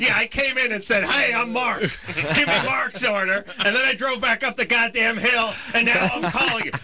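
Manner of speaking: high-pitched, exasperated mock confession; sarcastic. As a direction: rant with incredulous sarcasm. Sarcastic rant at restaurant worker.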